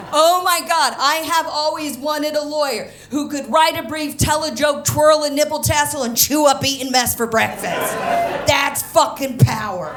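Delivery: loud, rising thrill, praising her lawyer; excited gushing. Real, not acted. Comic raving to the audience about her brilliant lawyer, loud and thrilled. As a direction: shout it with delighted awe.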